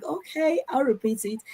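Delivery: high, soft, forced okay; put-on patient agreeableness; reluctant compliance. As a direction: act out agreeing politely against your will. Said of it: "you don't really want to repeat it".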